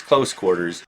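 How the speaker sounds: ordinary talk, nothing marked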